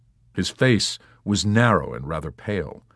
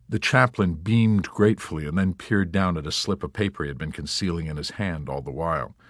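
plain reading voice

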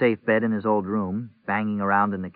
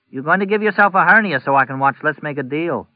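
sniffy complaint; 6 semitones higher, 6 dB louder than usual. A narrator's reading. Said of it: "she sniffed".

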